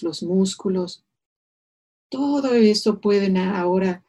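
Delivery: usual speaking voice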